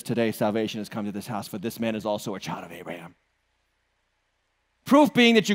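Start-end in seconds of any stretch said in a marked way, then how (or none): 0.0-3.1 gentle Jesus. Retold or imitated. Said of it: "Jesus says".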